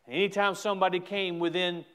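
ordinary delivery

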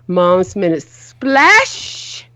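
slow title with a lifted "splash"; emphasis on splash. Shown as a demonstration. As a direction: present it like a show name. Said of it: "put emphasis on splash".

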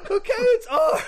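loud, high hysterical voice; hammed-up scream with laughter creeping in. Mother spelling out a letter under threat, screaming. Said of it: "She's screaming".

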